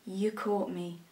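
low, soft sarcastic admission; hushed, measured mock surrender; mock confession. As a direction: confess with feigned shame, deadpan. Sarcastic confession to played accusation.

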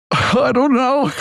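high, slow, strained "I don't know"; cornered trainee squeaking out an answer; exasperated squeak. Retold or imitated. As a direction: squeak it out helplessly, close to laughing. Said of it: "And I was like".

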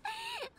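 light bright giggle, quick fall; a brief amused laugh before leaving; short laugh. Acted. 照れ笑いして話を切り替える. (軽く明るい笑い声)

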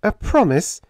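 high, fretful demand. A narrator's reading. Helen fretfully demanding Jim's promise. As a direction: press him, fretting. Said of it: "she demanded fretfully".

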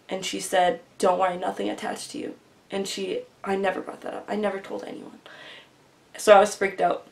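ordinary talk, nothing marked

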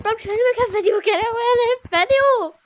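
fast, loud irritated yelling; annoyed shouting. Real, not acted. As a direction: snap at them to go away. Friend interrupts the recording.

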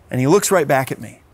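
ordinary delivery

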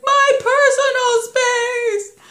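high, loud, drawn-out shriek; screaming child impression, words stretched out; imitated child's protest. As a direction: yell it slowly like an indignant toddler. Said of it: "taught him about personal space".

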